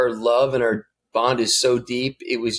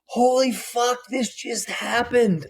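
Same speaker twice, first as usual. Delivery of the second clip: awed disbelief; 10 semitones higher, slower than usual. Retold or imitated. Retells his amazed relief.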